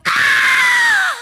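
sudden high scream of fright; scared shout. Acted. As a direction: yelp in shock at the corner. Fright at the corner.

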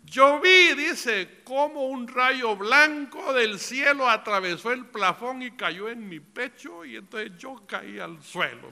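high, loud testimony retelling; impassioned preaching. A narrator's reading. Preacher relaying the brother's vision to the church, impassioned preaching.

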